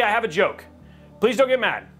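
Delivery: usual speaking voice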